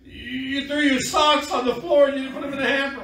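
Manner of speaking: loud, high-pitched hammed-up nagging; mock marital quarrel. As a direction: squabble like a fed-up spouse, playfully. Acted married couple's squabble.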